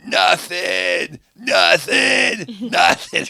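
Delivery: loud, high heckle, word hammered out; taunting shout. Retold or imitated. Fan shouting right in that lady's head, loud taunt. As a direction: yell it as a gloating fan, laughing between shouts.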